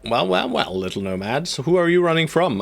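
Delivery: lightly voiced villain toying with prey; a hint of a smug enemy commander; gloating villain.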